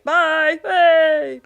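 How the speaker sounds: high, loud, drawn-out mock farewell; vanishing father's farewell. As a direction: call out a comic goodbye while being swept away.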